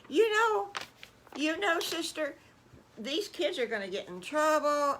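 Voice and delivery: high, fretful Aunt Petunia voice; warning aunt. Acted. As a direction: warn your sister with alarm. Petunia warns her sister.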